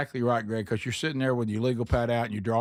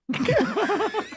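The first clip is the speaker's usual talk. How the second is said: big laugh. Real, not acted. Laughing at his own story.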